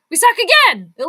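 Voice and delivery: high, loud cry of defeat; despairing yell. Real, not acted. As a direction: howl it at the screen. Outburst after bad play.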